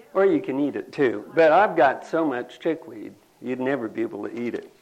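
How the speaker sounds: usual speaking voice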